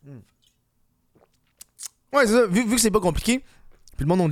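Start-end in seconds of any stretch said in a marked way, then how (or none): 0.0-0.2 humming mid-sip of water; while sipping a drink. Real, not acted. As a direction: hum with the glass at your lips. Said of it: "On va prendre une petite gorgée d'eau".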